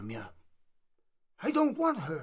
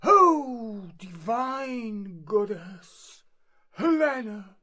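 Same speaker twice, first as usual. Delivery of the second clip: adoring exclamation; 8 dB louder, slower than usual. Acted. Lovestruck suitor wooing Helena.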